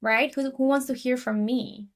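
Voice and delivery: ordinary talk, nothing marked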